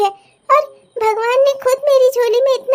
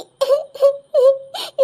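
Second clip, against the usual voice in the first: mother's sob. Acted. Mother sobbing.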